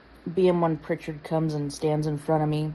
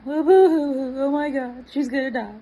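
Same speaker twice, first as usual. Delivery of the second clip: mock crying girls; 8 semitones higher than usual. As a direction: play the hysterical recruits. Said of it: "girls are crying".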